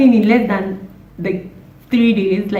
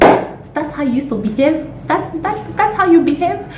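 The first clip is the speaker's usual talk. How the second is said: incredulous scolding; 7 semitones higher than usual. Acted. Mock scolding Romeo.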